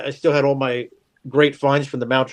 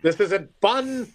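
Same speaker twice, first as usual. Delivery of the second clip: slow drawn-out whine; 6 semitones higher, slower than usual. Shown as a demonstration. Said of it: "There's a clip for you".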